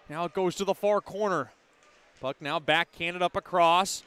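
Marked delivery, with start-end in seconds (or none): none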